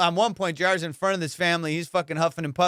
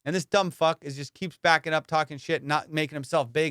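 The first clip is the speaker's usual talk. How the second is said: ordinary talk, nothing marked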